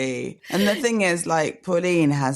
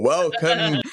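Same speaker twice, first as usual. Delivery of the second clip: ordinary talk, nothing marked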